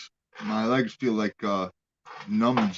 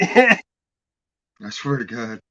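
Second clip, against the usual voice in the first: slow and earnest; 2 semitones lower, 5 dB louder, much slower than usual. Real, not acted. Insisting earnestly.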